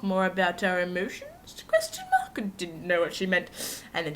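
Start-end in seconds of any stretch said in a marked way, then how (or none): 1.7-2.4 pitched way up in mock confusion; a high comic squeak. Real, not acted. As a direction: squeak the question mark.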